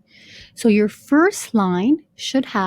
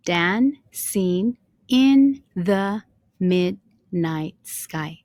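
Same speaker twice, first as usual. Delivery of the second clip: "Dancing in the midnight sky" very slow, each syllable apart; much slower than usual. Shown as a demonstration. Teacher showing line two has seven syllables, syllable by syllable. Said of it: "here you notice we have seven syllables".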